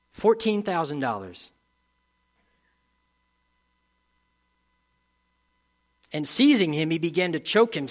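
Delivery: reading the parable with mounting urgency; scripture reading, rising intensity. Acted. Preacher reading the harsh servant's story, with urgency. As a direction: let the story build as you read.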